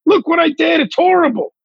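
high, pleading mock voice; mock plea. Comic to podcast host, begging to be cancelled, high mock plea. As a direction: squeal it out as a fake plea.

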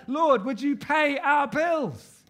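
high, drawn-out cry to God; booming, slow, high-pitched appeal; loud collective plea. Acted. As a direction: call out to the Lord at full voice, stretching it. Acts out a joint plea.

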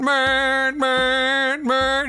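long, high monotone horn blare; drawn-out mock honking on one held note; imitated truck horn. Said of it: "he's just sitting there going".

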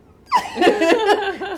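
high, loud laugh; burst of laughter. Real, not acted. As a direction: laugh out at the memory. Laughs at memory.

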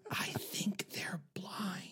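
slow, high stage whisper; whispering moviegoer. Retold or imitated. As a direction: whisper it slowly, imitating a cinema aside. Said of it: "I'm gonna do this quietly".

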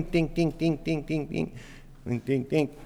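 playful tiny hammer taps; mock hammer tapping.

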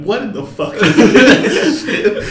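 slow, emphatic, laughing disbelief; deadpan outrage, dragged out; mock outraged Okoye. Voicing Okoye's reaction.

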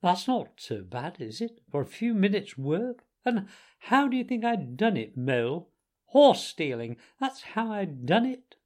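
high-pitched, pleased showing off; cocky braggart revealing his trick; boastful Toad. A narrator's reading. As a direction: brag with pitch swooping upward. Toad shows off his silver.

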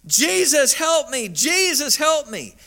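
high-pitched imitated cry for help; retold scream. Stabbed woman screaming out to Jesus, imitated high desperate cry. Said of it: "she started screaming out the name of Jesus".

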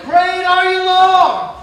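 shouted praise. Real, not acted. Preacher crying out praise to the Lord, loud. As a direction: proclaim it like a cry of praise.

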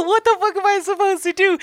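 high-pitched, laughing mock despair; exasperated retelling. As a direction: recount it as a high, helpless plea. Retold plea to the passengers.

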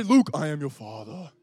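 low, deep movie-villain voice; mock Darth Vader line, played for laughs; Darth Vader impression. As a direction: ham up the famous villain reveal. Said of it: "Star Wars?".

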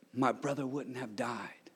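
Mary whispering her grief to Jesus; grieving whisper. Acted. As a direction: whisper it, heartbroken.

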